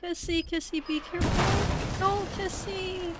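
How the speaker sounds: high, pleading call to a friend; desperate pleading. Real, not acted. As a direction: beg her to stay safe. Pleading with a doomed character.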